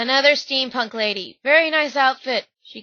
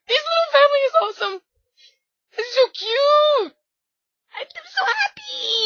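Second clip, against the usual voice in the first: squeeing; 3 dB louder than usual. Real, not acted. Fan squeeing over a cosplay family. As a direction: gush in a strained squeal over the cute family. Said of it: "I'm squeeing too much".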